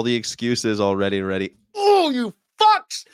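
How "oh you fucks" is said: high, loud, drawn-out cry; hammed-up exasperated yell; mock outrage. Mock outrage at clip's excuses.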